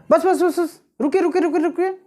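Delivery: high, quick string of stop words; rapid urgent repetition. Shown as a demonstration. Teacher demonstrating stop to students, high hurried repetition. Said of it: "ध्वनि में और अर्थ में".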